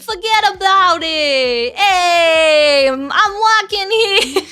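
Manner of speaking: slow, drawn-out Italian-American New Yorker; New York Italian accent. Acted. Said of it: "He's from New York".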